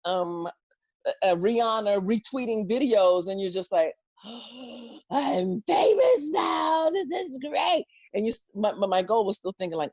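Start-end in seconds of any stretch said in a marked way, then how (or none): 4.3-4.8 gasp. Retold or imitated. Overwhelmed by [name] retweet. 5.1-7.9 high, slow thrilled cry; excited squeal. Retold or imitated. Imitating own excited reaction.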